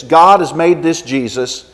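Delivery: usual speaking voice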